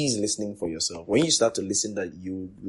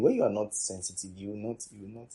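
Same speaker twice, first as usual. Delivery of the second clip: normal talking voice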